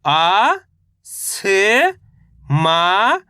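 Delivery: each syllable held long, separated by pauses. Shown as a demonstration. Teacher sounding out a word for reading learners, syllable by syllable. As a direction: sound out each letter slowly, stretching it. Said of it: "एक एक अक्षर का उच्चारण".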